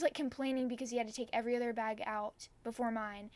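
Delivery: normal talking voice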